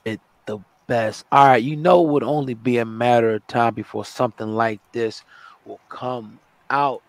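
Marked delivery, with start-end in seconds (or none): none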